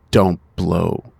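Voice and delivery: normal talking voice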